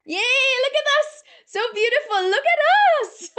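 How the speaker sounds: very high, loud squeal of delight; thrilled cheering. Real, not acted. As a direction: cheer the whole group on for a photo. Cheering the group for a photo.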